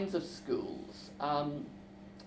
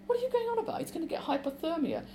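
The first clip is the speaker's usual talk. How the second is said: furious, much higher-pitched outburst; 13 semitones higher, faster than usual. Acted. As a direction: snap back at him in alarm, high and angry. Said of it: "say furiously".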